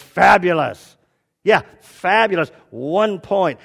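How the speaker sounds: loud, punched congratulation; emphatic mock praise. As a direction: boom it out like a pleased examiner. [name] acted praising, then scoring.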